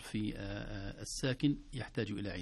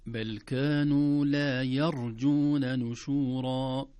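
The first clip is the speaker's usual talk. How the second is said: slow, louder tajweed recitation; slow, louder recited verse; Quran recitation; 7 dB louder, much slower than usual. Shown as a demonstration. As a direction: recite the verse in full voice, slowly. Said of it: "يرجون فيها تنبيهان".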